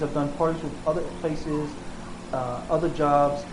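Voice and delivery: ordinary talk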